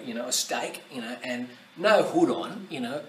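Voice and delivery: usual speaking voice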